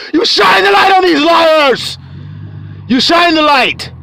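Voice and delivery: high, ringing preacher's holler. Real, not acted. Preacher exposing the liars, hollering. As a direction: holler it out with full conviction. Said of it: "Grandson, why you holler?".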